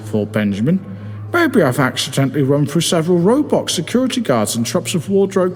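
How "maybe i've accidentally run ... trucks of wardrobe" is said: pitched up and hurried, thinking aloud; a man talking himself through confusion; higher, rushed inner panic. Acted. Benjamin's panicked inner thought.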